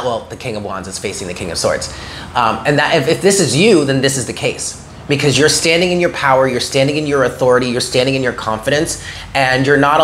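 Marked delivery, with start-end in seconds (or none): none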